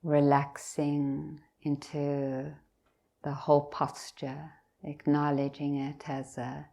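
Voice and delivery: unhurried meditation guidance with long pauses; guided meditation. Real, not acted. Teacher leading meditators from the top of the head down, guided meditation. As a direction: guide them gently, leaving long silences between phrases.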